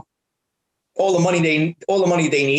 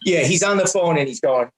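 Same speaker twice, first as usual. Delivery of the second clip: normal talking voice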